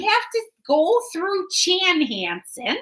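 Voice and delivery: a light goofball Minnesota accent, long round vowels. Shown as a demonstration. Host showing off her Minnesota accent to viewers. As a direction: play up the Minnesota twang for fun. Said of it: "say 'em in the, uh, Minnesota sounding".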